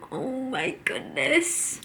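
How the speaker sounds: normal talking voice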